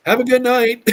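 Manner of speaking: high, loud mock farewell; carefree send-off. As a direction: call out a hammed-up, breezy goodnight. Wife's carefree text, voiced for laughs.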